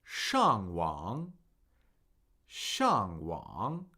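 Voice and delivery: 上网: drop hard on 上, then dip and rise on 网; tone demo. Shown as a demonstration. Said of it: "If I say the tones".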